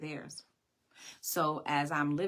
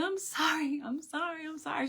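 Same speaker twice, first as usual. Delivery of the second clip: put-on high, pleading child's sorry; tearful apology; 13 semitones higher than usual. Daughter apologizing to her mother, high and pleading. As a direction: beg forgiveness like a little girl.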